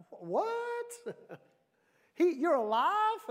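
very high, hammed-up disbelief; astonished king. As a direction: squeal it high, as if you can't believe it. King finds Daniel alive.

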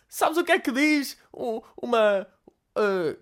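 high, slow granny fumbling; granny losing her punchline; high mock-granny stalling; old woman voice. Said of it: "uma velha desdentada".

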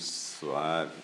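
usual speaking voice

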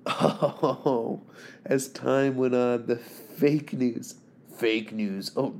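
ordinary talk, nothing marked

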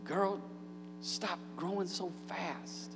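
soft, slow pleading to a daughter; tender plea. Retold or imitated. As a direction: beg her gently to stop growing. Parent pleading with growing daughter.

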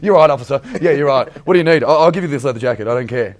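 loud, over-eager surrender to a policeman; hammed-up frightened compliance; mock panic. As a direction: blurt it out, desperate to please. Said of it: "I'm sitting there shitting myself".

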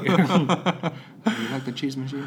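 put-on slow French-accented English, laughing; imitated French accent. French friends asking about their raclette machine, imitated French accent. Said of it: "Is that how they said it?".

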